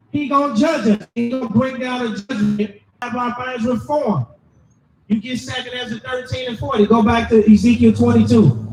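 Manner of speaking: ordinary talk, nothing marked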